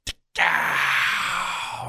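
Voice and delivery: a soft, breathy "tick-ow" like a far-off rifle crack; imitated rifle shot. Hunter mimics the far-off shots he heard at dawn, soft breathy gunshot sound. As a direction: mouth the sound of a distant gunshot. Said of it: "And I hear another one".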